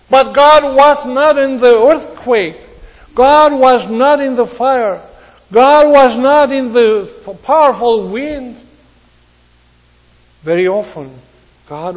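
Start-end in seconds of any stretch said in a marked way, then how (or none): 0.1-8.6 louder, higher, pounding each line; forceful preaching. Acted. Preacher to congregation, hammering each line louder. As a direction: drive the point home to the congregation.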